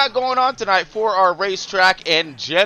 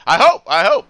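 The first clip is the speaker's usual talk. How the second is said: higher and louder each time; rising squeal; 9 semitones higher, 8 dB louder than usual. Acted. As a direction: crank the pitch way up. Repeated hope, rising in pitch.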